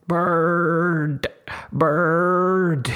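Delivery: high, loud scream of a single word. Acted. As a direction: shriek it like a tantrum. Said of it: "stamping her feet and screaming".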